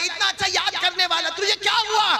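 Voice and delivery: high, climbing cry of disbelief; full-force preaching. Acted. Teacher questioning dead student in a dream, impassioned.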